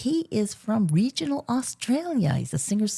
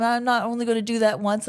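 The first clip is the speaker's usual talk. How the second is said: ordinary talk, nothing marked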